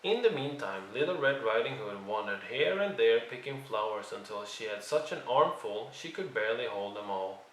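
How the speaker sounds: usual speaking voice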